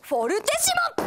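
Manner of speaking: high shout of the special move; shouted move name. Acted. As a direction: yell the shot's name. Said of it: "進藤キャプテンの力を借ります".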